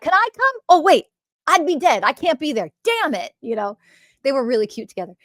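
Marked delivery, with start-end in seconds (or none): 0.0-3.3 high, louder eager then mock-disappointed; playful disappointment. Husband realizing he'd be dead, high and playfully foiled. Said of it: "He's like".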